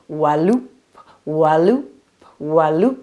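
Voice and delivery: rising whoosh of dragon wings; wing flap sound. Acted. The dragon's wings beat through the sky, a loud rising whoop. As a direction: sing out each wingbeat.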